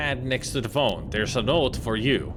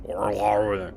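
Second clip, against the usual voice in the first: mock pirate growl; 4 semitones lower than usual. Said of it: "Gabby doesn't talk like that".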